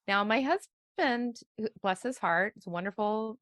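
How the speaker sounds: normal talking voice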